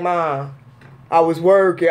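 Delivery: low-pitched imitation of a son; grown son. Son explaining himself to mom by phone, imitated low. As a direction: drop low like a put-upon son.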